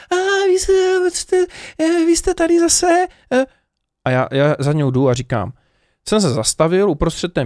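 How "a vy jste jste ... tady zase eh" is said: shrill, very high mock-shocked squeal; shocked shop assistant. Mimicking the blonde clerk gasping that the customer is back, very high. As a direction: parody her wide-eyed disbelief at seeing him back. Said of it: "a úplně zase psycho".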